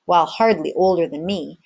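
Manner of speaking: plain reading voice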